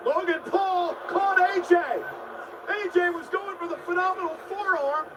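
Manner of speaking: rising excited call of the catch; excited shout. Real, not acted. Live call of a big reversal.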